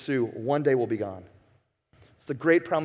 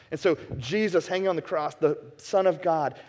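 ordinary delivery

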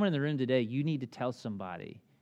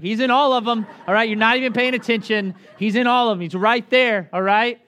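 louder and higher, mock exasperation; dad imitating his own frustration at the son; exasperated dad; 6 semitones higher, 8 dB louder than usual. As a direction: scold the kid in disbelief.

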